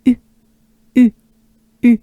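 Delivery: short, separated French U; French u demo. Shown as a demonstration. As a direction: tight French U in crisp single sounds. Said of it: "to pronounce".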